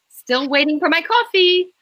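high, pointed and impatient; pointed demand. A narrator's reading. Rachel glaring at Damien, impatient pointed demand. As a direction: needle him about the coffee.

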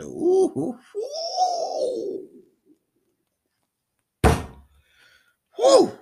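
high hooting excitement; loud high ooh and woo; excited whoops. Real, not acted. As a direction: whoop at the shock of the news. Reacting to headline.

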